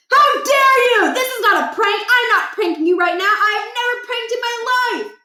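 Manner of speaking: put-on high, loud indignant old-man cry; outraged old father. Scene: father insulted by son's prank joke, outraged old man. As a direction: bristle at the accusation.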